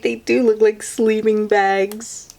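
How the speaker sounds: usual speaking voice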